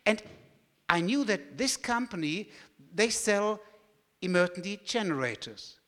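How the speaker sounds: usual speaking voice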